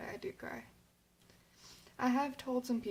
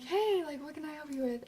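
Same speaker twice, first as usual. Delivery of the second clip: a lightly voiced, higher, bright greeting from the advisor; mild imitation of a delighted teacher; cheery advisor; 6 semitones higher, 4 dB louder than usual. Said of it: "lit up and was like".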